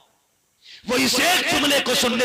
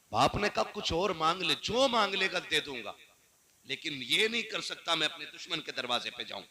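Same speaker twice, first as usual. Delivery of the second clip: hushed dialogue; 4 semitones lower, 7 dB quieter than usual. Acted. Hushed dialogue, father and son.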